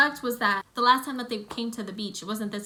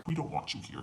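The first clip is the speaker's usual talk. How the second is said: low, softer pretend creature voice; stingray voice; 7 semitones lower, 5 dB quieter than usual. Stingrays telling swimmers to leave, low mock creature voice.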